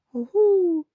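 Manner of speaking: high, loud gloating hoot; gloating heffalump. Acted. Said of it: "gloating sort of way".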